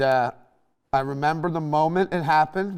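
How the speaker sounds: usual speaking voice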